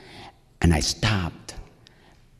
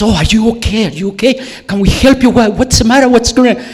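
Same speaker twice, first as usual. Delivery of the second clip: high, loud, fussing concern; mock alarmed receptionist; 13 semitones higher, 7 dB louder, faster than usual. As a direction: imitate a flustered receptionist firing off questions.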